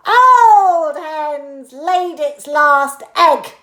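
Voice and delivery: loud, slow mock-wailing granny; loud slow old woman; Grandma shouting. A narrator's reading. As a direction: wail over the hen as Grandma. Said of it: "Grandma shouted".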